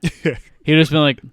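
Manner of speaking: normal talking voice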